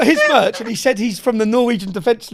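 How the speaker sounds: high, loud burst through laughter; laughing. Real, not acted. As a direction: crack up while getting the words out. Laughing over a retold prank.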